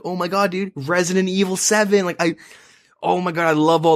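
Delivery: excited. Retold or imitated. Excited gamer retelling hype.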